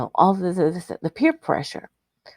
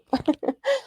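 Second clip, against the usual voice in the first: giggle; 10 semitones higher than usual. Real, not acted. Reacts to comment.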